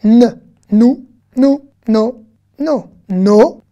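na, né, nou, no, ni read out loud one by one; reading syllables aloud. Shown as a demonstration. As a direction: call each syllable out clearly for the class. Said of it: "ce que nous allons lire tout de suite".

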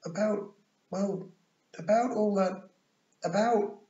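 high-pitched, halting false starts; nervous boy. A narrator's reading. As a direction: stumble awkwardly into an apology. Nervous boy stumbles into apology.

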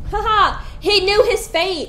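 loud, high triumphant crowing; gleeful gloat. Real, not acted. Player gloating loudly at a frozen enemy, triumphant. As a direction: crow over the frozen enemy.

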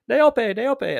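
called out high and bright in welcome; excited greeting. Acted. Housekeeping staff greeting Neope as she arrives, calling high and excited. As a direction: sing the name out to welcome her.

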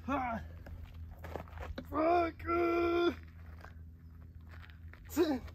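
high strained grunts on last rep; straining. Real, not acted. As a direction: force out the final rep under strain. Pushing out the final rep.

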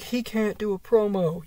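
high mock-critic voice; mocking imitation. Fan quoting people who doubt the wrestler, mocking high voice.

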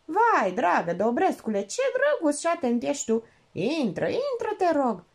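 high, gushing welcome; delighted hostess welcoming the lieutenant; delighted exclaim. Acted. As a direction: gush with delight at the guest. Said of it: "Minerva exclamă încântată".

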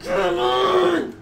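loud, high exasperated yell; frustrated shout. Real, not acted. Game frustration, yelling.

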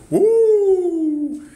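loud high falsetto woo; loud whoop. Real, not acted. Cowboy celebrating the gentle giant line, loud high woo. Hype whoop on live stream.

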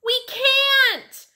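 put-on very high, loud kid's yell. A narrator's reading. Fudge answers Sheila while twirling, yelling. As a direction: yell back at the top of your pitch. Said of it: "Fudge yelled".

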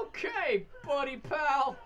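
high, loud buddy-pal voice; cheery counselor. Pretend. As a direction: play a peppy camp counselor. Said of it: "cheery counselor persona".

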